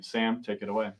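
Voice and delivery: usual speaking voice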